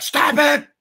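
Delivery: put-on high, whiny child's "Stop it!"; a kid losing patience; mad kid. Kid yelling at a rhyming cartoon cat, high and whiny. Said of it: "I would get mad".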